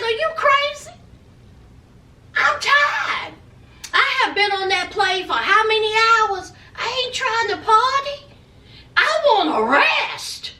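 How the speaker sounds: high-pitched mock protest; exasperated girlfriend. Tired traveler refusing to party, high exasperated imitation.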